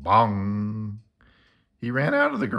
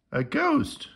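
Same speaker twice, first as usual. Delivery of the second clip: excited. Acted. Explaining ghost proudly.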